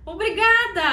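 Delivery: delighted, grateful thank you; gushing thanks. Shown as a demonstration. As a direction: thank them with a big smile. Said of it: "você fala".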